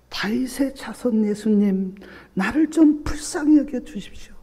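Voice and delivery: high, pleading call of a blind beggar; a beggar's high pleading cry to Jesus. Retold or imitated. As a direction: cry out for mercy, reaching high. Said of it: "그렇게 외쳤는데".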